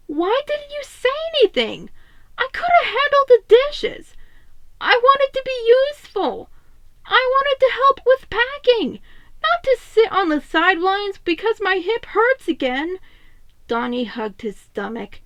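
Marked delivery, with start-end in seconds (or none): 0.1-9.0 high, loud, frustrated plea; high upset Donnie. Acted. Donnie venting to Raph about feeling useless, high and upset. 9.4-13.0 upset. Acted. Donnie ends his outburst.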